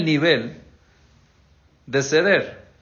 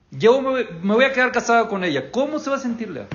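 raised reproach; 7 semitones higher than usual. Retold or imitated. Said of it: "Es lo que le decía Jacob".

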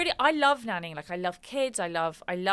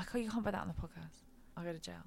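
hushed, rushed near-whisper; mock secrecy; 12 dB quieter, much faster than usual. Nanny admitting off-the-books work to host, hushed mock secrecy. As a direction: drop to a conspiratorial hush as if off the record.

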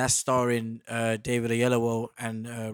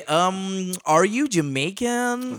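higher, slow mock American question; imitating Americans; 7 semitones higher, 6 dB louder, much slower than usual. S0 mimics Americans hearing his London accent, mock American voice. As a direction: play the puzzled American asking. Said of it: "they say".

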